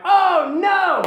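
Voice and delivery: high, loud hammed-up cry; mock dismay. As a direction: cry out as the trap springs, exaggerated. Acted dismay as trap springs.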